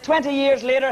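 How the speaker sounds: ordinary talk, nothing marked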